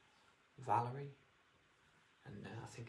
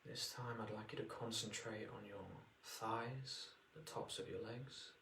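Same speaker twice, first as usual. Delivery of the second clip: guided meditation. Acted. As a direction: ease them into relaxation.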